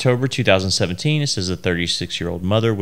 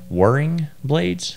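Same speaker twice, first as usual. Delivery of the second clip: drawn out, puzzled; slow and unsure; 6 semitones higher, much slower than usual. Real, not acted. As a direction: sound it out slowly, unsure. Unsure reading of a hard word.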